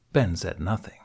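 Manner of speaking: plain reading voice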